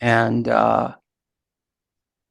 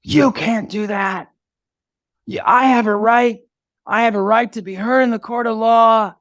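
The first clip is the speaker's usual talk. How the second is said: angry tirade; 9 semitones higher than usual. Retold or imitated. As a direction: act out an outraged activist yelling in court. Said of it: "incredible anger".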